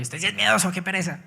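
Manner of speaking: teasing wife. Retold or imitated. Husband imitating his wife calling him a coward, mocking. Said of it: "Mi esposa me dice que yo soy muy, muy miedoso".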